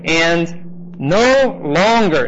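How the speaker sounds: slow, weighted stress on "no longer"; emphatic. Shown as a demonstration. Teacher stressing "And no longer" in a slow emphatic read. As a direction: lean hard on "And no longer", drawn out. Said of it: "now emphatic".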